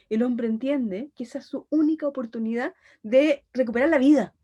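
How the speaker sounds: ordinary delivery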